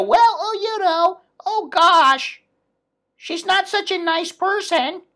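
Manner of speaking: high, prim, dainty mock voice; mock prissy. Mocking the polite guy, high and prissy. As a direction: mince it like a prim lady. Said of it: "They're not gonna go".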